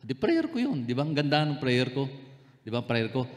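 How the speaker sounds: usual speaking voice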